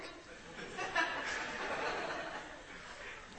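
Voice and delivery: breathless laughter. Real, not acted. Preacher laughing at the sinking boat idea, breathless laughter.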